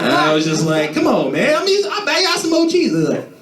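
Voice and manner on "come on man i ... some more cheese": high whiny plea; mock pleading. To his roommates, a high whiny plea. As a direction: beg them, squeaky high.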